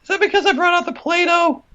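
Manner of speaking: put-on squeaky high child calling for dad; high-pitched child voice asking dad; whiny little kid. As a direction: play a needy little child begging dad for answers.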